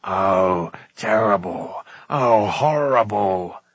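low, drawn-out wail of dismay; moaning. A narrator's reading. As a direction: moan it slowly in horror. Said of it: "moaned Gurgi".

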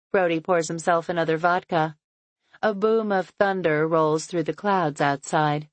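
plain reading voice

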